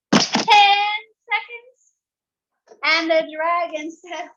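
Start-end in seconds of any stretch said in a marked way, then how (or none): 0.1-1.9 high, slow, rising "ten seconds"; teasing challenge. Acted. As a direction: dare him with wide-eyed doubt. Princess challenging the dragon.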